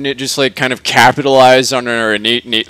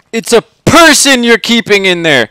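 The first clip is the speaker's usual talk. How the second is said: higher-pitched indignant objection; mild protest; 7 semitones higher, 4 dB louder than usual. A narrator's reading. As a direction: protest as an exasperated customer. Charlie protests to the AI.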